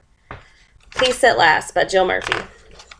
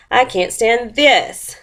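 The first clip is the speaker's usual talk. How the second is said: higher, slow, "this" drawn out; stress on THIS; 4 semitones higher, slower than usual. A narrator's reading. Mister Bear fed up with the clock, stressing "this". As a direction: lean hard and long on "this". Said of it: "it's all capital letters".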